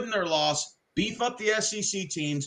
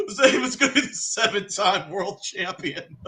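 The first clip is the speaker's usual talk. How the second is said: laughing while talking; 4 semitones higher than usual. Real, not acted. Laughing through the story.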